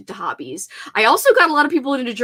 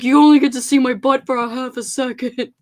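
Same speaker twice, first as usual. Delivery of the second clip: voicing the dog; 3 semitones higher, 6 dB louder than usual. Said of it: "He's like".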